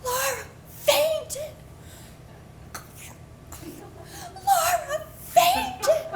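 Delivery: high, slow, half-laughing panicked wail. Retold or imitated. Child to family in stands, slow high panicked wail. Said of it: "And I'm going".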